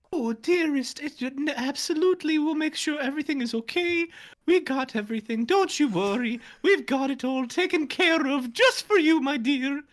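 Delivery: very high, loud fussing mother voice; high falsetto doting mom. Acted. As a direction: coo like an overbearing mom. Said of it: "I hate that I'm about to do this".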